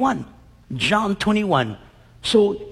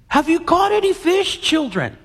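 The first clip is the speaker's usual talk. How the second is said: calling out; 10 semitones higher than usual. Acted. Jesus calling from the shore.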